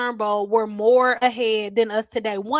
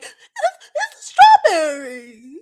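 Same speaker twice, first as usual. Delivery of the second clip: much higher mocking voice breaking into laughter; high put-on voice, laughing as she rattles off a silly recipe; mock silly follower; 9 semitones higher than usual. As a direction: ridicule the DIY recipe crowd, laughing through it. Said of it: "Now we like".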